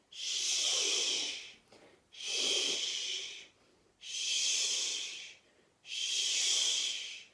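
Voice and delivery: long voiceless shushing swish; skiing swish. Shown as a demonstration. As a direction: hiss like skis sliding down snow. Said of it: "go skiing down a snowy mountain".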